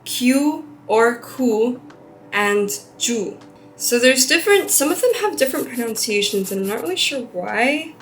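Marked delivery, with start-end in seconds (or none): 0.0-1.8 kyu or ku spoken slowly and carefully; careful learner's kyu or ku; slow Japanese numbers. Shown as a demonstration.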